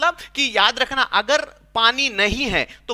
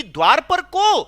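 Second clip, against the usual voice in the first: high, raised, drawn-out challenge through a door; Radha calling out; 5 semitones higher, 4 dB louder, slower than usual. Acted. Radha asks from inside who is at the door, calling out. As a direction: call out slowly to whoever is knocking. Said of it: "अंदर से राधा रानी बोलती है कि".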